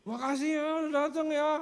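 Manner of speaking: high, loud, distorted voice; put-on strained patient voice; injured friend imitation. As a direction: mimic thanks through a battered mouth. Imitating badly injured friend thanking visitors.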